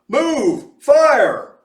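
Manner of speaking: loud high urgent yell; shouted. A narrator's reading. Mose yells at the stuck trolley, full urgent shout. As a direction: bellow it to clear the road. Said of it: "Mose cried".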